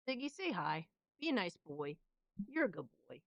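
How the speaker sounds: soft coaxing pet talk; cooing at the cat. Real, not acted. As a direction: coax a grumpy cat gently. Coaxing her cat on camera.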